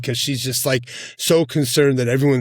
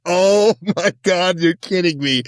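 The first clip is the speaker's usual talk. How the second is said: disbelieving gasp; 5 semitones higher than usual. Retold or imitated. Reliving watching the wedding scene, stunned disbelief. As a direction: stretch it out in shocked disbelief. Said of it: "And I was just like".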